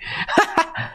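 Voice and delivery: a short, airy, unvoiced laugh; breathy high laugh. Real, not acted. Commentator laughs in disbelief at the news, airy laugh. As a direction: burst into a breathless chuckle.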